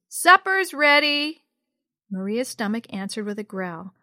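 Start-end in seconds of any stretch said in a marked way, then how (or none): 0.1-1.4 high, loud, drawn-out call; mom calling upstairs. A narrator's reading. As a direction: call the family to supper from downstairs. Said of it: "Mom's voice echoed upstairs".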